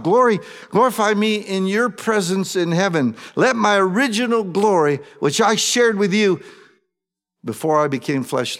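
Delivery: high, loud impassioned prayer; fervent prayer. Acted. Jesus praying to the Father, loud fervent plea.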